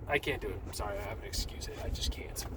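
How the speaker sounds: soft, half-voiced mock apology; acted timid refusal; meek excuse. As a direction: beg off feebly, barely voicing it. Acting out a reluctant chosen person.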